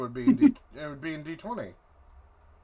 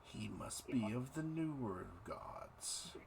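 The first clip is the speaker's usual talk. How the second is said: eldritch horror; 3 semitones lower, 4 dB quieter, slower than usual. Acted. Deity keeps speaking to player.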